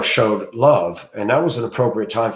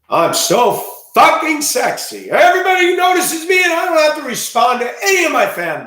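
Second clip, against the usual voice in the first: much higher and louder, shouted in a mocking put-on voice; mock vain influencer; 6 dB louder than usual. Mocking social media girls who crave attention, high put-on shout. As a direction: ham up a self-adoring girl online.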